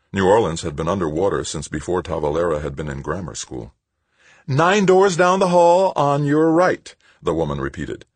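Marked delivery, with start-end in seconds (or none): 4.5-6.9 high, loud, slow repeat; insistent sweetness. A narrator's reading. Woman repeating directions to Tavalera, insistently sweet. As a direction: spell it out slowly and brightly, as to a child.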